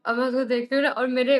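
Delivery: ordinary delivery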